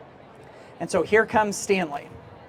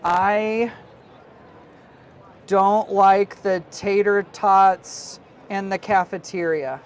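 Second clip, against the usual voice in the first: slow dictation; 4 dB louder, much slower than usual. Shown as a demonstration.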